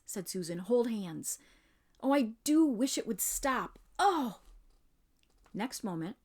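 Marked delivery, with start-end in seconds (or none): 0.6-4.4 frightened. Acted. Susan frightened by magic pull.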